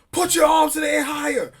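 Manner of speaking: high-pitched mock cop yelling; imitated barked order. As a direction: bark it like an officer shouting orders. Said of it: "you barking out a dozen different instructions".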